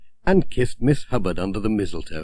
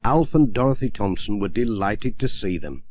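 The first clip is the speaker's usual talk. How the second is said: ordinary delivery, nothing marked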